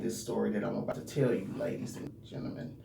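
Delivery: soft whispered aside; whispering. Acted. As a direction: drop to a whisper. Whispered address to viewers.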